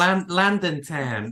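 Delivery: slow, high Landon Tan in a put-on London accent; attempted Cockney. Shown as a demonstration. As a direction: drag out Landon Tan like a geezer. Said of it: "I can't do it".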